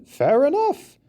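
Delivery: high conceding repeat. A narrator's reading. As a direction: elephant giving in with a lift.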